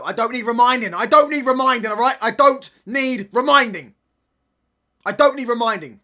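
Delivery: loud outburst. Real, not acted. Shouting at chat about his club's bad results, exasperated. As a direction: snap back hard, fed up and loud.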